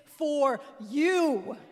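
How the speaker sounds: slow, loud proclaiming call; ancestor calling out. Acted. Peterson calling to descendants, slow loud proclamation. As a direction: cry it out slow like a voice from the past. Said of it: "Peterson calling from eighteen seventy".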